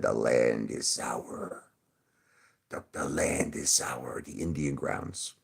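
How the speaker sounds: low, slow, soft ominous voice; hushed, drawn-out spooky warning; Pet Sematary quote. Acted. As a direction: intone it like a horror-film warning. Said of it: "Remember they said".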